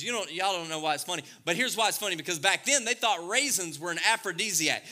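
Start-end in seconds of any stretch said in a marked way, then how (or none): none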